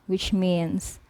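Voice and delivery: ordinary talk, nothing marked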